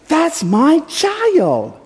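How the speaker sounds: high, drawn-out cry of a delighted father; beaming proud. Acted. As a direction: boast with a huge smile, voice way up. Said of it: "says with a huge smile on his face".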